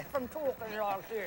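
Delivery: slow mock-posh royal talk; joining in the prince impression. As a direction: imitate a tight-mouthed royal, slowly. Joins in mocking royal speech.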